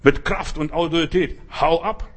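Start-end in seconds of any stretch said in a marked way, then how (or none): 1.6-2.1 louder, with force and authority; firm command to the devil to leave; forceful command. Shown as a demonstration. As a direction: say it with authority, like you mean it. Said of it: "du musst auftreten und energisch sein".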